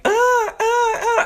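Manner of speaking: high, slow mock screech, ah ah ah. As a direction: squeal like a girl getting beaten up. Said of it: "Heather is just screeching".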